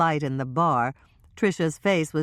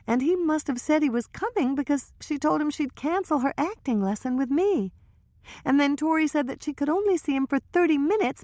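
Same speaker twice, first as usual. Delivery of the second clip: put-on higher young woman's voice, hushed gossip; Trisha's voice; 12 semitones higher than usual. Trisha confiding to Hannah and Michelle, higher nervous voice.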